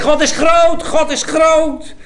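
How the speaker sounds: high, loud, slow proclamation; Isaiah insisting God is great; proclaiming loudly. Acted. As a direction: cry it out to the crowd. Preacher voicing Isaiah's proclamation.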